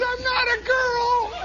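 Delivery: very high, drawn-out wail; hammed-up tearful squeal; mock crying protest. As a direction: wail it like a bullied crybaby. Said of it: "He's crying".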